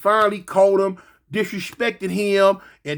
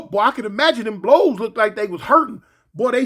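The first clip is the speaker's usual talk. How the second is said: ordinary delivery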